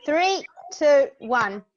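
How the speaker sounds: loud count to the group; loud countdown. Real, not acted. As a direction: call out the count to everyone.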